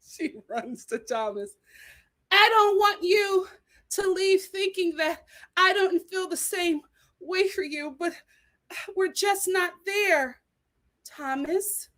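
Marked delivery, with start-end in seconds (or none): 2.3-11.9 higher, slow, teary plea; a lightly voiced crying Becca; mock tearful Becca. Becca tearfully telling Thomas they're not there.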